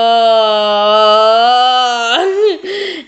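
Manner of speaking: long helpless laugh; burst of laughter. Real, not acted. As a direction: crack up out loud. Reaction to lovebirds line.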